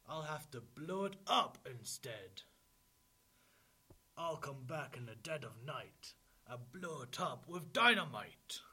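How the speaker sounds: wolf threat building to a shout; threatening wolf. A narrator's reading. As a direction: build loudly to a huge DYNAMITE. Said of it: "Wolf said".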